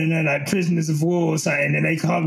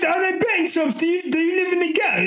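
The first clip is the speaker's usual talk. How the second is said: much higher, fast mock questioning; mock quote; 11 semitones higher, faster than usual. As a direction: imitate the question with a high squeaky voice. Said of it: "You just said".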